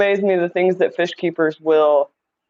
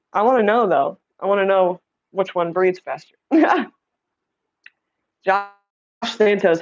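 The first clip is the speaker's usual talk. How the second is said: normal talking voice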